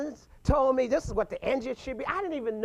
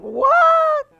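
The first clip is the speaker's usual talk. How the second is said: shocked outburst; 14 dB louder than usual. Retold or imitated. Reacting to pawnshop lowball offer.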